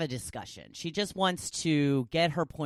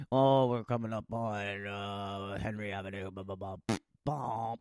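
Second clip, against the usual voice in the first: bus announcement voice; 6 semitones lower than usual. Retold or imitated. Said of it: "automated voices announcing the stops".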